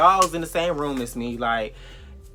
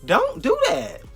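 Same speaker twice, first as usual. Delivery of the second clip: retold going off; 6 semitones higher, 4 dB louder than usual. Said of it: "went off on his ass".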